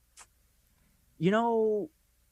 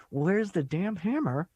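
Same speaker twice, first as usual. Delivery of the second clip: slow, higher, mock-dramatic despair; half-joking editor fed up with editing; 6 semitones higher, slower than usual. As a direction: ham up the editor's breaking point. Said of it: "you're like".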